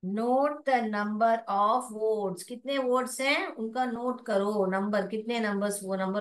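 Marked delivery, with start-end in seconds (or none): 0.1-2.4 slow and careful, each word clear; slow model reading again. Shown as a demonstration. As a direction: model it slowly for students. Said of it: "teacher model reading". (साफ़-साफ़ धीरे पढ़ना)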